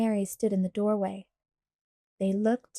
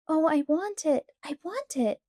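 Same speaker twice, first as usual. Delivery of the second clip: put-on child's begging, pitched higher; 7 semitones higher than usual. A narrator's reading. Laura begs Pa for the Indian baby, higher pleading. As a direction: plead like a little girl who wants something badly. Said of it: "Laura begged".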